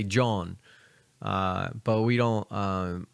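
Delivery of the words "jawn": "John" said as the Philly word jawn; Philly-style "John", rhyming with dawn; Philly slang pronunciation. Shown as a demonstration. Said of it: "in Philly, we say".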